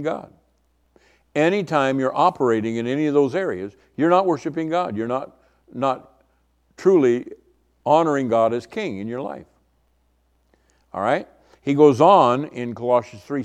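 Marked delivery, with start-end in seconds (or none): none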